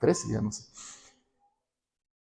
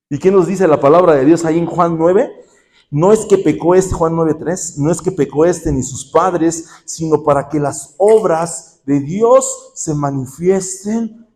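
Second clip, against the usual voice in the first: fervent preaching; 2 semitones higher, 4 dB louder than usual. Real, not acted. Preacher proclaims John nine.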